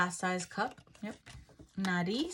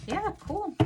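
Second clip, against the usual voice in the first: excited; 12 semitones higher than usual. Real, not acted. Reacting to box opening.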